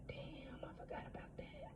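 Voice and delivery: very soft, breathy realization; stunned whisper. Retold or imitated. Remembering something buried when a friend brings it up, whispered. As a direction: barely breathe it, caught off guard. Retold own stunned reply.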